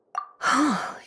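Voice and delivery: a short, soft gasp of wonder; surprised gasp. Acted. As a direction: catch your breath in amazement. Surprised at remembered scents.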